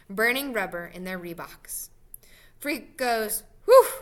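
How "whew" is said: blown-out "whew" of relief; sospiro di sollievo. Acted. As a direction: puff out a relieved breath. Boy relieved after narrow escape.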